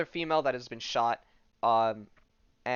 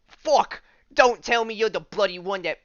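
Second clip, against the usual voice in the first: louder, higher attempt at British swearing; British accent; 7 semitones higher, 8 dB louder than usual. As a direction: do your best brash British accent. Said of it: "he could talk in a British accent, but I'll do my best".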